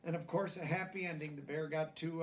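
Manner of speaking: ordinary delivery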